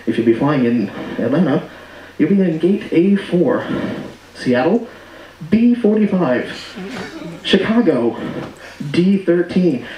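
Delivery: low, slow pilot intercom voice; pilot announcement. Retold or imitated. Pilot listing terminals, lightly voiced low drawl. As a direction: read the gates like a mild captain announcement. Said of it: "he goes".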